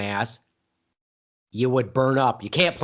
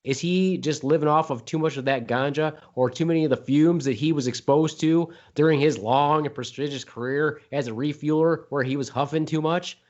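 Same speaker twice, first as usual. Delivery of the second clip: usual speaking voice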